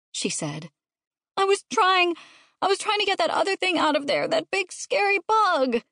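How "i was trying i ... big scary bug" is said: high-pitched upset wail; child crying out. A narrator's reading. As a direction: plead your excuse like a distraught kid. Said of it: "River cried".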